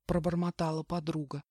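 usual speaking voice

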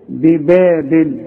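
ببابل slow, with each ب thin; light ba. Shown as a demonstration. Teacher recites ببابل with thin ب sounds. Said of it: "الب هنا مرققة ازاي".

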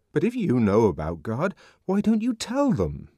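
high-pitched indignant challenge; indignant question, climbing in pitch. A narrator's reading. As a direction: demand an answer indignantly, voice rising high. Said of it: "asked the savage indignantly".

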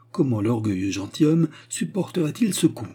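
usual speaking voice

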